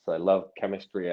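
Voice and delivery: normal talking voice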